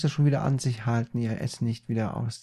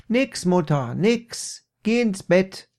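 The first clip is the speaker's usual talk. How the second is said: higher, raised-voice brush-off; calling back; 7 semitones higher, 6 dB louder, slower than usual. Acted. Peter sends his mother to bed, raised high call. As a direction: shoo mother away, raised voice.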